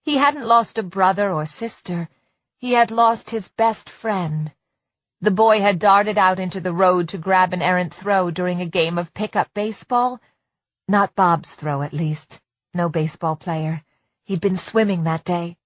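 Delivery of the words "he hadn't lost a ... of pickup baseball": plain reading voice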